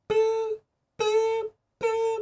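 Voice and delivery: high, drawn-out beeping like a heart monitor; monitor beeps. Retold or imitated. Mimicking the hospital heart monitor's beeps. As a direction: chirp the boops like a hospital machine.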